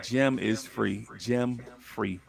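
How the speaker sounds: slow, low imitation of an ancestor; Jim's way of talking. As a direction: answer slowly and low, letting "Jim Free" fall. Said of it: "That's how he talked".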